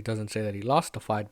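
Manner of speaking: ordinary delivery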